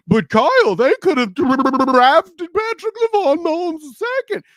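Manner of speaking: high, loud, frantic whining protest; over-the-top high-pitched heckler voice; mock objector. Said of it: "don't give me the".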